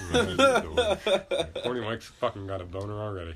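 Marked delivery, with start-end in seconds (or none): 0.1-1.2 high, slow laughing pickup line; sleazy Mike voice. Pretend. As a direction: ham up the creepy charm while laughing. Horny Mike persona again.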